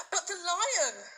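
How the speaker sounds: high, slow anguished cry. A narrator's reading. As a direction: cry out in alarm for the lion. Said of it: "he cried".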